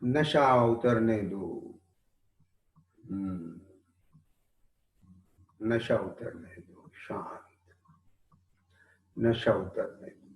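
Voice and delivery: slow, soft, letting go; meditative calm. Real, not acted. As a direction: ease them down gently. Meditative instruction.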